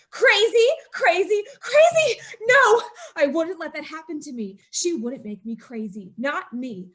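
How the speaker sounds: ordinary talk, nothing marked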